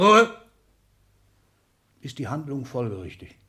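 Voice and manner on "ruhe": shouted high and loud; barked order. Acted. Exzellenz shouts the secretary down, loud and high. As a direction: bark him into silence.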